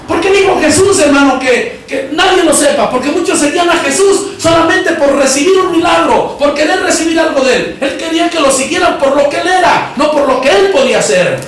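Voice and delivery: high, insistent sermon delivery; preaching. Real, not acted. Preacher explaining why Jesus said tell nobody, insistent high preaching. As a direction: drive the lesson home to the church.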